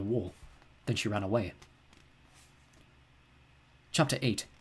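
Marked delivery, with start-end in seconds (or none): none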